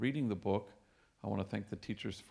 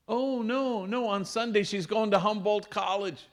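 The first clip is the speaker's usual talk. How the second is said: high, loud, bursting with excitement; excited dad; 9 semitones higher, 5 dB louder than usual. Retold or imitated. Proud dad on the phone, high and excited. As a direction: blurt out the happy news, way up high. Excited father calling priest.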